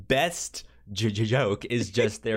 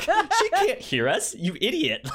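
mock exasperated scolding; 6 dB louder than usual. Retelling the doctor insulting the cop, high laughing mock scold. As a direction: squeak it out through laughter.

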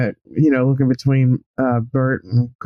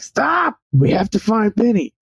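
hollering; 8 semitones higher than usual. Acted. Earl calling after Bernie, high-pitched mock holler. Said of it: "Earl's gonna holler".

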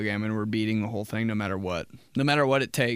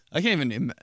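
ordinary delivery

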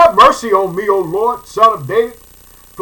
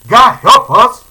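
louder cry calling on God; loud plea; 2 semitones higher, 9 dB louder, slower than usual. Retold or imitated. Preacher showing the church how to cry out, loud plea. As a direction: call out to God for help. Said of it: "This is what we're gonna be saying".

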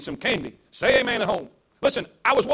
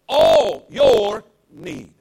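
loud, slow, stretched proclamation; calling the congregation to shout it back; declared loudly; 8 dB louder, much slower than usual. Shown as a demonstration. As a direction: draw it out and belt it. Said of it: "Say it, say it at home".

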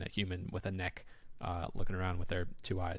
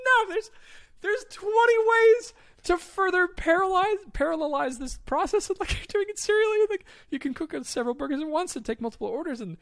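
much higher and louder, fast exasperated rant; 9 dB louder than usual. Retold or imitated. Retelling Barbados burger wait, high exasperated rant. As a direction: vent the frustration, half laughing. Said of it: "it just drove me crazy".

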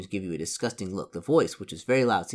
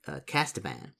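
normal talking voice

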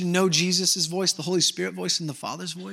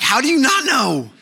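high, loud mock disbelief; scandalized retort; 8 semitones higher, 7 dB louder than usual. Wife challenges husband over their child's cry, scandalized. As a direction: throw it back, appalled and comically loud.

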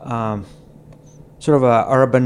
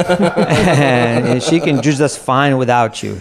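laughing; 3 semitones higher, 5 dB louder than usual. Real, not acted. Laughing at girlfriend joke.

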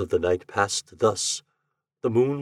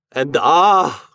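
cry; 4 semitones higher, 8 dB louder than usual. A narrator's reading. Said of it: "cried he".

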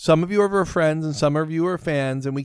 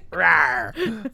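mock dinosaur rawr, high and laughing; dinosaur roar; 8 semitones higher than usual. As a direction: play a playful little raptor. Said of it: "Look what you're doing".

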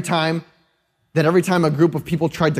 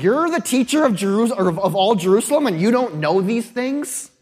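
higher, incredulous snark, mocking the teacher; snarky Jesus; 5 semitones higher than usual. Jesus mocks Nicodemus for not knowing, snarky and incredulous. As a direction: scoff at him for not knowing. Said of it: "I wanna believe it's snarky".

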